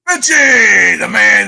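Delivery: loud, high hype-man shout. Real, not acted. Cohost hyping up his buddy with a loud shout. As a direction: pump up the crowd.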